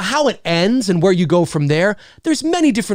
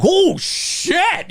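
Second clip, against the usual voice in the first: high, drawn-out exclamation; acted amazed viewer; shocked reaction; 12 semitones higher than usual.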